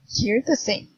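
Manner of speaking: normal talking voice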